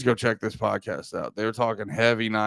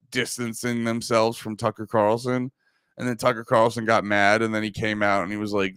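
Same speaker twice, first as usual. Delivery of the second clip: normal talking voice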